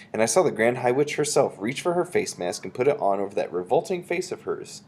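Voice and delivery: usual speaking voice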